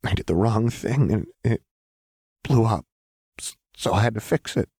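hollow. A narrator's reading. Jinx blaming herself to Lux, hollow and halting. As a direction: explain it brokenly, hollowly. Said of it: "Jinx said hullily".

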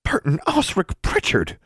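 shocked high exclamation; scandalized. Acted. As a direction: scold the full name, scandalized. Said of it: "Constance's scandalized tone".

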